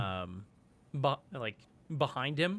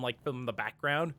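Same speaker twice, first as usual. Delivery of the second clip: normal talking voice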